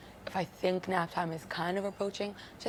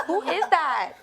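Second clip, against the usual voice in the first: higher, louder startled cry, laughing; startled shout; 10 semitones higher, 10 dB louder than usual. Retold or imitated. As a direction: replay the scare, louder and laughing. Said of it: "and I was like".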